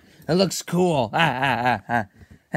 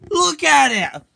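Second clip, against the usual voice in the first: much higher, louder yell; louder shouted order; 9 semitones higher, 7 dB louder than usual. Acted. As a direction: really yell it at him. Forcing friend to watch movie.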